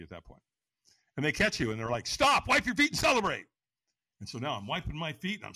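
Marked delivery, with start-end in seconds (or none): 2.1-3.5 high, loud shouted command; shouted order. Retold or imitated. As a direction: bark it like a staff member hyping the crowd. Firewalk staff shouting at him.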